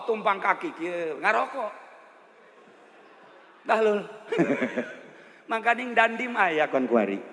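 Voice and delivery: ordinary talk, nothing marked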